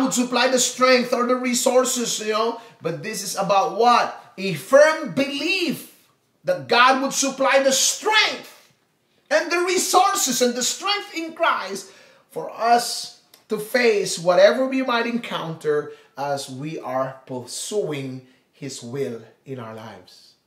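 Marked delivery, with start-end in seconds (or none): 4.4-15.9 fervent sermon peak; emphatic preaching. Acted. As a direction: proclaim it to the whole church. Preaching to the congregation.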